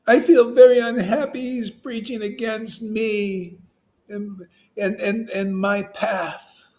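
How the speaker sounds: lightly hammed-up high whine, slow; a hint of a sulking devotee, high and slow; mock hurt devotee. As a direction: drag out a sulky grievance. Mock devotee keeps complaining.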